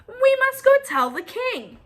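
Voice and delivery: high, loud urgent call; urgent birds. Acted. The birds urge Goosey Lucy along, high and loud. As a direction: push on in a hurry.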